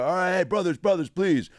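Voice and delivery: mock plea for order. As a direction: call a rowdy meeting to order. Acted plea to settle the room.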